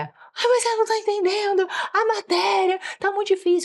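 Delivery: high-pitched mock whine; exasperated schoolchild's outburst; child yelling. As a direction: imitate an overwhelmed kid wailing in complaint. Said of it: "a gritar alto com você".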